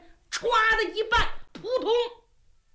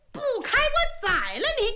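furious threat; 12 semitones higher than usual. Acted. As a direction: swing the sword up and threaten to kill. Princess raises sword, threatens.

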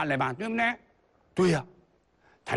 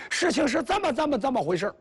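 high flustered; 11 semitones higher than usual. Acted. 徒弟慌张解释.

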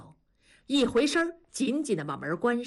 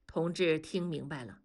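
ordinary delivery, nothing marked

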